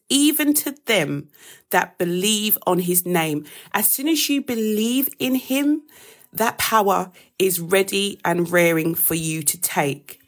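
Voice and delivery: ordinary delivery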